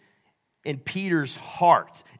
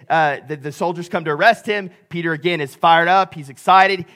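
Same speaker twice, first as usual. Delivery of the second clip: ordinary talk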